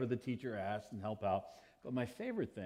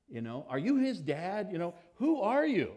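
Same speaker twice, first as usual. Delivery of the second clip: put-on pestering kids; 7 semitones higher, 5 dB louder than usual. Kids pressing the question.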